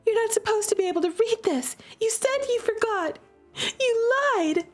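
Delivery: high, rising accusation; accusing. Acted. Sister confronting her brother over the journal, high and angry. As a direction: confront him with the lie.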